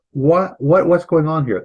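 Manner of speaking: ordinary talk, nothing marked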